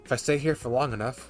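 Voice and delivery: normal talking voice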